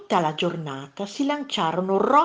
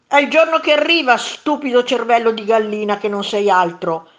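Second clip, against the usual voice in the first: loud, high, rapid-fire start; angry retort; 4 semitones higher, 9 dB louder than usual. A narrator's reading. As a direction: burst out with a shouted insult. Second monster hurls insults back. (ribatte urlando, arrabbiato)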